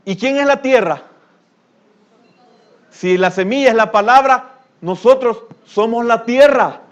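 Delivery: high, loud preacher's challenge; fiery preaching. Acted. Preacher challenges the congregation at full force, loud and high. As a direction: hammer the question at the congregation.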